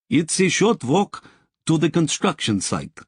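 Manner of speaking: a Russian worker greeting a VIP; Russian accent. A narrator's reading. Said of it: "Her accent was richly Russian".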